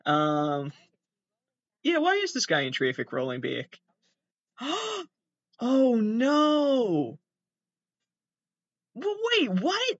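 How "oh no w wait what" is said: much higher, alarmed "Oh no", then a disbelieving "what?"; alarmed, then in disbelief; real shock. Real, not acted. Seeing a car roll onto a motorcycle, shocked high cry.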